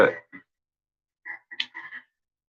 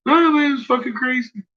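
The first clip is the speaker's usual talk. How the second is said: very high, loud, giddy friend voice; imitating a friend; 3 dB louder than usual. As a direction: squeak it out like a freaked-out buddy. Hearing from my own friends.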